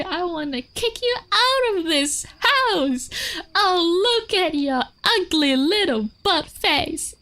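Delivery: high, drawn-out doting squeal; gushing pet talk. Shown as a demonstration. Showing viewers that dogs hear tone over words, gushing high pet talk. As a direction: coo insults as if they were compliments. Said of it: "intonation usually it's more important than the content".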